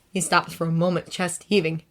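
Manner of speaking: ordinary delivery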